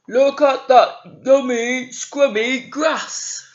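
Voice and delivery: loud, slow greedy goat voice; big billy goat relishing the grass. Acted. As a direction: savour every word like a hungry goat. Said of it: "said the big billy goat".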